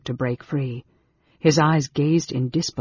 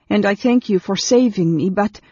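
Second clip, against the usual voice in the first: put-on high, louder girl's voice; anxious girl; 6 semitones higher, 5 dB louder than usual. A narrator's reading. Girl thanks her rescuer while struggling free, anxious. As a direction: pitch up, breaking off uneasily.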